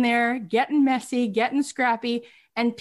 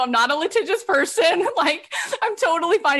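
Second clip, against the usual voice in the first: higher-pitched, emphatic reassurance; retold insistent reassurance; 6 semitones higher than usual. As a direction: wave away the fuss, pitched up. Retold reassurance of worried director.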